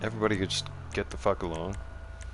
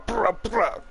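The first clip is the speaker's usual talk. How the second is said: high, mouthed gun sounds going brah brah; mock gunfire noises; 13 semitones higher than usual. Mocking lobby kids by imitating their drive-by shots, high gunfire noises. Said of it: "just go brah".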